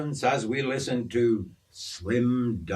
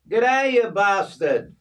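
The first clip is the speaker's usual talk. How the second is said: high, loud Aussie greeting with a grin; cheeky, high-pitched hello; grinning greeting; 10 semitones higher, 5 dB louder than usual. Acted. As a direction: hail a mate with a big grin. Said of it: "with a huge grin on his face".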